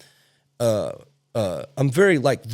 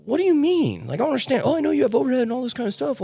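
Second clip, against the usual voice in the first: mock-outraged what do you mean, pitched way up; incredulous retold outburst; 10 semitones higher than usual. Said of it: "And I'm like".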